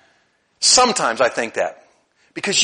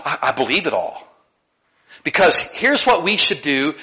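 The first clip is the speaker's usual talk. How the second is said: ordinary talk, nothing marked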